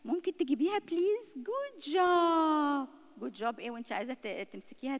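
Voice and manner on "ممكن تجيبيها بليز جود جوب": high, drawn-out sweet praise; mock sugary mother. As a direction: overdo the syrupy good job. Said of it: "هتيجي تقول".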